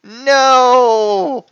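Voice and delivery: high, loud dramatic no; hammed-up cry. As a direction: wail it at the climax. Skit climax cry.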